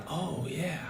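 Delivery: hushed mock-guilty police voice; mock sheepish cop. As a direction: mutter like a caught-out official. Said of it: "And they're like".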